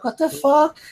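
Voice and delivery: high, squeaky mock disbelief, pitch jumping way up; stunned disbelief. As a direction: replay the shock of getting the phone back. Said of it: "I'm like".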